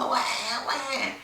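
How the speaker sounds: high, thin mock baby wail; newborn cry imitation. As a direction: imitate the baby's first cry.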